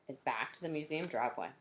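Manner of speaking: usual speaking voice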